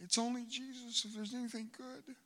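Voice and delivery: ordinary delivery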